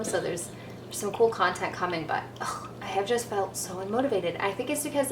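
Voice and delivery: hushed unmotivated whisper. Real, not acted. Quiet aside while brewing coffee.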